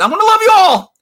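hammed-up father. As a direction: boom it out like a showy dad. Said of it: "And say".

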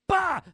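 high, loud "bah" of a kick; shouted impact noise. Retold or imitated. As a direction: burst out a comic-book hit sound. Imitates a kick landing.

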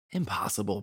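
ordinary delivery

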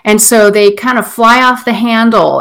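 ordinary talk, nothing marked

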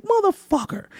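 high mock-indignant curse; retold indignant curse. Storyteller recalls being refused a tour spot, indignant.

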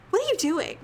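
high, irritated questioning; mildly exasperated retold demand; seething question. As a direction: re-enact confronting someone who broke your focus. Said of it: "I'm only seething".

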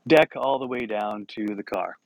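ordinary talk, nothing marked

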